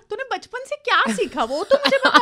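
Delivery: laughing mock rant at the cook, high, loud and fast; mock scolding in Hindi. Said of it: "So I speak like her".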